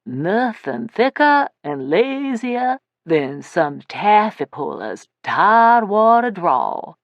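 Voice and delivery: slow, lazy Tidewater drawl, stretched out; mock a Virginia Tidewater drawl, taking it slow; slow Tidewater Virginia accent, mocking the lowland boys. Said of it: "Only he says it in a Tidewater accent".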